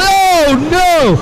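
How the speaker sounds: high, sudden oh no at a crash; real alarm as a car catches fire; startled announcer crying out; shocked cry. Real, not acted.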